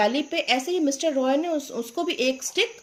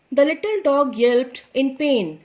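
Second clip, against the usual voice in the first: slow story reading; 5 dB louder, much slower than usual. Shown as a demonstration. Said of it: "teacher reads story slowly".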